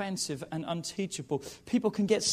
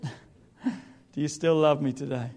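laughing tease; 3 semitones lower, slower than usual. Real, not acted. Teasing the congregation.

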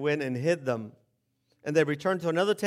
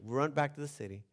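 ordinary talk, nothing marked

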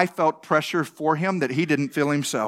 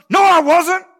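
high, loud indignant denial; defensive shout; 10 semitones higher, 8 dB louder than usual. Retold or imitated. Pastor snapping back at Brenda in denial, loud and high.

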